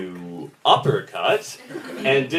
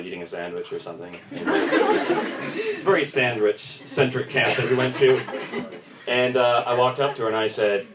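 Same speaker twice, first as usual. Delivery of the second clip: ordinary talk, nothing marked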